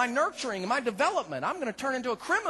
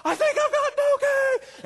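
very high, loud, slow scream; 9 dB louder, slower than usual. Retold or imitated. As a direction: scream it at the top of your lungs. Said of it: "I start screaming at the top of my lungs".

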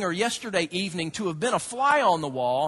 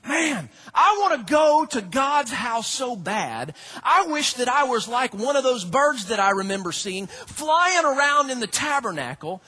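louder, pleading songwriter voice; longing psalmist; 4 semitones higher, 3 dB louder than usual. Retold or imitated. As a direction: ache to be in God's house. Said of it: "You know what he was saying?".

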